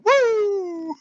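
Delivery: very high whoop; imitated kid's woo. Dad imitating his kids in the other room, whooping. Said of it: "And I hear the other room".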